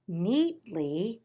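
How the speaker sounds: plain reading voice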